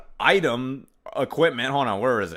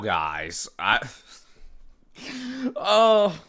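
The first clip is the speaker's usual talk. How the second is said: usual speaking voice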